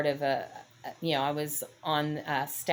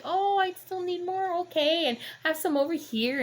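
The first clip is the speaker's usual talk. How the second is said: put-on little old lady; 13 semitones higher than usual. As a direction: play a flustered old lady counting coins. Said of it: "that little old lady".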